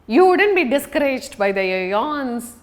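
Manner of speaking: usual speaking voice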